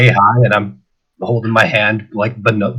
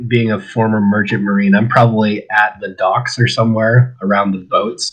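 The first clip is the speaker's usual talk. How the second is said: ordinary delivery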